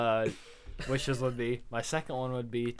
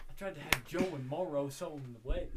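usual speaking voice

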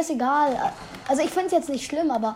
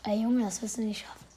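hushed teasing whisper; 4 semitones lower, 5 dB quieter than usual. Real, not acted. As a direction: whisper it low and taunting. Teasing whisper during game.

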